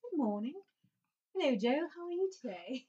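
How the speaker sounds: a gentle English morning hello; English accent. Acted. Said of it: "how's that sound?".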